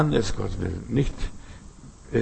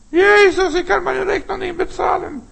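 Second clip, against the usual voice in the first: high, raised cry to Jesus; worried plea; 4 dB louder than usual. Pretend. Worried believer calling to Jesus, raised plea. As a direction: call out your money worries. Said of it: "Wenn du Sorgen hast".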